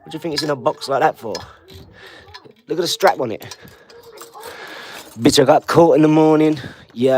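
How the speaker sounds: loud, swaggering street boasting about the watch; loud street bravado. Acted. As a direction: show off the watch loudly, full roadman swagger. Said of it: "You talk like that all the time".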